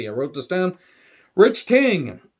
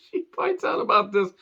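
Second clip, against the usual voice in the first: laughing; 11 semitones higher, 4 dB quieter than usual. Real, not acted. Cracks up repeating the line.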